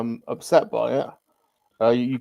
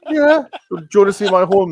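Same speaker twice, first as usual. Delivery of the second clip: loud, high and fast boast; excited shout; 7 semitones higher, 9 dB louder than usual. Acted. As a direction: shout it out proudly. Showing off horn loudly.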